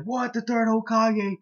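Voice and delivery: high-pitched, mock-astonished squeal; freaking out. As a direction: shoot the pitch up in disbelief. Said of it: "everybody's, like, fucking freaking out".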